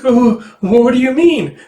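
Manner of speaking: high, loud, drawn-out protest; feigned innocence. Acted. Caught cheat answering an accusation, high overdone innocence. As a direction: play innocent far too hard.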